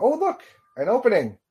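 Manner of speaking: high, light mock delight; mock excitement. Commentator voicing Bernie spotting the opening, mock delight.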